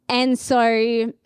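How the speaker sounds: usual speaking voice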